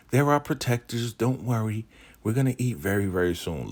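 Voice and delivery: high, put-on placating voice; reassuring woman. Woman explaining why men eat first, high and placating.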